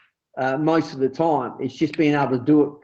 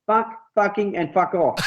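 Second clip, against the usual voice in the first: imitated French accent, pitched up; 5 semitones higher than usual. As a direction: mimic a Frenchman swearing in English. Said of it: "a, a French accent".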